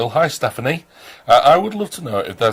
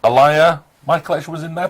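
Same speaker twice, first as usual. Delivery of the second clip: usual speaking voice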